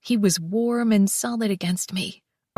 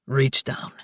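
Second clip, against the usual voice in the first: low commanding lover; deep male voice; 9 semitones lower than usual. Acted. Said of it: "he said between thrusts".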